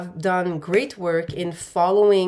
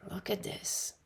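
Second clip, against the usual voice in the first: awed whisper; 14 dB quieter than usual. Real, not acted. Reader marvels at a new card.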